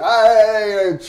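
a sudden loud, high shout of "Hey"; cop's shout. Retold or imitated. Greek cop shouting at the crowd of travellers to move along. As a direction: bark it out to herd the crowd.

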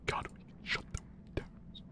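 very soft whisper, barely voiced. Real, not acted. Streamer whispering so as not to trigger the mic. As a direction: hush it right down to a whisper. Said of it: "trying not to trigger the mic".

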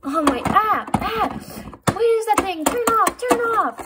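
high, loud panicked cries. Pretend. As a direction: jolt awake in alarm. Woken by a blaring alarm.

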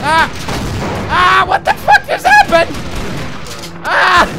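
high, loud panicked yells; frightened shouting, voice jumping high; scared yelling. Real, not acted. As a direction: shriek in real fright at the slugs. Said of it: "I'm scared!".

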